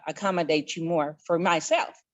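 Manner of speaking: usual speaking voice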